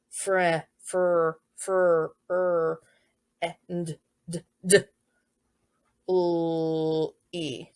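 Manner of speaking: she is f, fr, fr, d, l, e sounded letter by letter; sounding out. Shown as a demonstration. Teacher shows a child sounding out "friendly", slow. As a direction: stretch out each sound of "friendly" like a beginning writer. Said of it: "you can hear how they listen to the sounds".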